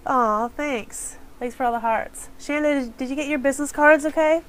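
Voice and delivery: ordinary delivery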